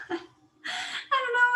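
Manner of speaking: slow, high drawn-out zombie moan; zombie sound. Shown as a demonstration. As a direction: let a long zombie groan slosh out. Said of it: "that's like a zombie sound for me today".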